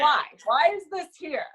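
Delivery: high, plaintive retold questioning; mock-distressed high complaint; exasperated protest. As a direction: act out protesting an unfair workload. Dismayed at the assigned work.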